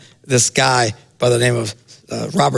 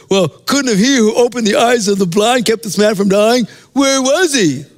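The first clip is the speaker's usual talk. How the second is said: higher, whiny griping voice; whining complaint; 7 semitones higher than usual. Retold or imitated. Onlookers at the tomb complaining in a higher, whiny voice. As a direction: gripe it like a complainer in the crowd. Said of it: "Everybody's whining somewhere".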